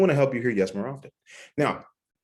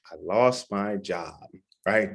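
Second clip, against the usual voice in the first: ordinary talk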